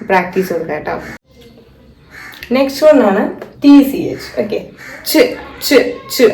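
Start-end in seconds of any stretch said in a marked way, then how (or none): 5.1-6.3 clipped repeated ch; teacher demo of ച്; ch sound. Shown as a demonstration. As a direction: model the ch sound with a silent t. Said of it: "അങ്ങനെയാണ് ഇതിന്റെ pronunciation".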